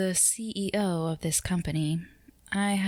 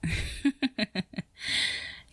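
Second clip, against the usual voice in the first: amused laugh; 5 semitones higher than usual. Acted. Boss amused by listener.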